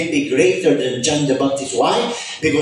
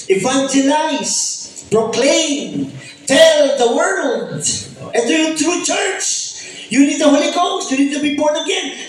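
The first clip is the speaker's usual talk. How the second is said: preaching at full force; 12 semitones higher than usual. Acted. Pastor sending the church out, proclaiming fervently. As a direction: proclaim it like a charge to the world.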